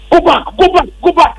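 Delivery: high-pitched urgent crowd shout, imitated; retold shouting. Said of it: "They started shouting".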